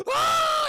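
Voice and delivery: very high, loud horrified shriek. Retold or imitated. [name] sees her face in the mirror, piercing shriek. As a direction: shriek at your own reflection.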